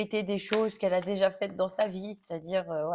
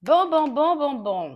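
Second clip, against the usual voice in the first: loud, brisk self-rallying; 6 semitones higher, 10 dB louder, slower than usual. Real, not acted. Snaps herself back to reading.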